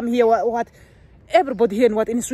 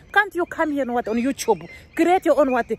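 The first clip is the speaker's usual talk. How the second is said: ordinary delivery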